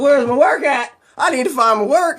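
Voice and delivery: high, mildly panicked fretting; freaking out. Acted. Man searching his messy desk for his work, freaking out. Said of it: "So he starts freaking out".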